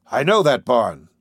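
loud yelped recognition, pitch jumping up then falling. A narrator's reading. Driver spotting a barn in the blizzard, yelping. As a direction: yelp it out suddenly. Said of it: "he yelped".

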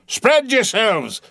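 high, slow, drawn-out loud command; Ahab crying out. A narrator's reading. Captain orders the boats to spread out, crying. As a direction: stretch the order across the water. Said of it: "cried Ahab".

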